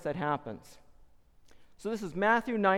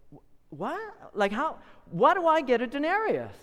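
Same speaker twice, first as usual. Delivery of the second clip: halting, high-pitched shock; stunned disbelief; 8 semitones higher than usual. Acted. Worker handed one coin, high and stunned. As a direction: stammer out the question, astonished.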